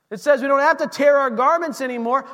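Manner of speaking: normal talking voice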